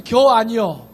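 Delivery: 충청도 사투리로 툭; Chungcheong dialect. Retold or imitated. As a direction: drop it punchily in Chungcheong dialect. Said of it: "세 글자".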